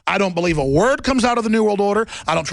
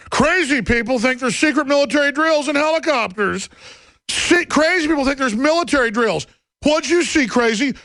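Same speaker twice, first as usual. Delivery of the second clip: higher-pitched mock scoffing; mocking ridicule; 6 semitones higher than usual. Media scoffing at drill believers, mocking. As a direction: imitate a scornful reporter, pitched up.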